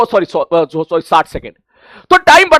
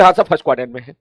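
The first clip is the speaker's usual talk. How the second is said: student answer; 10 semitones lower, 4 dB quieter, much faster than usual. Acted. Teacher voices student answering.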